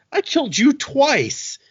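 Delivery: high, loud, drawn-out complaint; hammed-up outrage, stretching the words; indignant disbelief. Lord Ruler confronts Kelsier.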